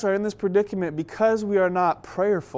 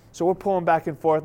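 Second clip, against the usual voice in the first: ordinary talk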